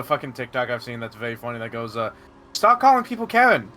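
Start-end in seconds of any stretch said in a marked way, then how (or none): 2.5-3.7 put-on thick Australian accent, high and loud; broad Aussie voice, a bit shouty. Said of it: "it's a very thick Australian accent".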